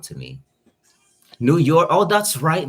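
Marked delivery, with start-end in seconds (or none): 1.4-2.7 much louder and higher, a sudden delighted outburst; light up as it clicks; excited recognition. Real, not acted. Streamer recalling viewer Nicole lives there, excited outburst.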